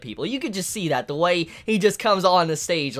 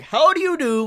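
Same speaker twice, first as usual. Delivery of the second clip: mock Furuta greeting; 7 semitones higher than usual. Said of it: "and he's like".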